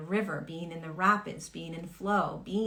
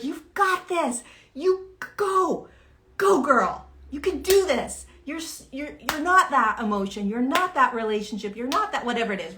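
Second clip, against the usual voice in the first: much higher, louder hype-friend encouragement; cheering someone on; 6 semitones higher, 5 dB louder than usual. Retold or imitated. Friend hypes up someone in hard times, high cheering. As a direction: pump them up like a best friend.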